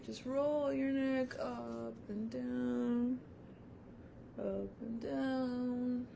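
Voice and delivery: slow, drawn-out relaxation cue, unhurried; slow guided exercise. Real, not acted. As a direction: stretch each word out with the neck roll. Leading a slow neck-roll exercise.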